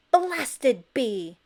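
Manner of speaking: loud angry bellow; angry loud shout. A narrator's reading. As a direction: bellow at the neighbor. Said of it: "he bellowed".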